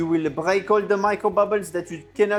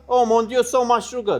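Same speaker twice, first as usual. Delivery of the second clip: excited exclamation; 5 semitones higher, 6 dB louder than usual. Real, not acted. Mock alarm at sugar amount.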